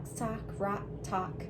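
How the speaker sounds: ordinary talk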